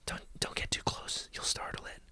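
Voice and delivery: urgent whisper; barely voiced, cautioning whisper; hushed warning. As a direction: whisper it so the animal won't hear. Warning a child near wildlife.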